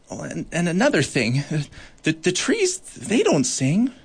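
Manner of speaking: high, earnest little voice; timid small beetle. Acted. Bark beetle explaining to Goliath, small and earnest. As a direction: gain a bit of courage as you go.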